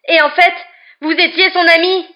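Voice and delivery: high, loud accusation; indignant yell. A narrator's reading. Boy accusing professor of secret friendship, yelled indignantly. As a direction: yell the betrayal in outrage. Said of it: "hurla-t-il à Lupin".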